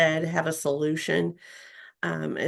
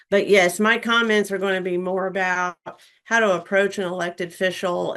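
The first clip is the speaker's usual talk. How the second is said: ordinary delivery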